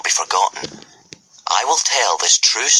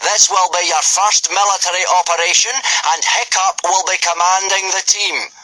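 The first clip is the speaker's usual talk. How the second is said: booming Viking teacher; 11 semitones higher than usual. Acted. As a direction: bark the order at the recruits. Gobber addressing boys.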